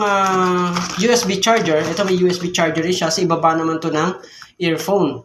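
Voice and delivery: usual speaking voice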